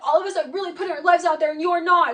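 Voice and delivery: mock yell. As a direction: rattle off his rant loud. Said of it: "He's like".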